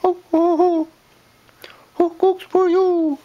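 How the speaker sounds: high hooting owl call. Retold or imitated. Parent hoots for daughter, high owl call. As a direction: hoot it like a barn owl.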